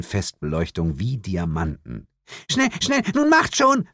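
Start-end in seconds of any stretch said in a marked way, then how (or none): 2.5-3.9 put-on very high, calling girl's voice; a little girl urging her parents on, high-pitched; high excited child calling out. A narrator's reading. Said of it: "rief das Mädchen".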